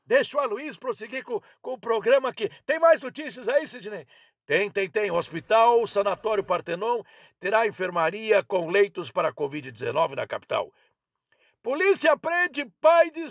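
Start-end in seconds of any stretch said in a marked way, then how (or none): none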